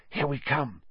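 soft hushed voice; whispering. A narrator's reading. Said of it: "Hermione whispered".

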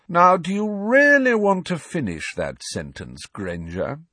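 lightly voiced sneering rival; sneering Malfoy. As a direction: taunt her with silky menace. Malfoy taunting Hermione.

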